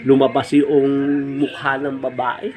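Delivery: usual speaking voice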